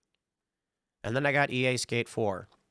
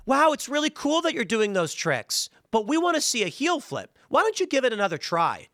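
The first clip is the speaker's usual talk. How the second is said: much higher, overly nice tutor voice; mocking gentle tutorial; 9 semitones higher than usual. Tutorial voice coaxing the player to retry, mocked high and sweet. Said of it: "they go".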